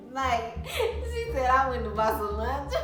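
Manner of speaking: laughing through the words; half laughing, half crying with amusement. Real, not acted. Laughing about mom's rumor.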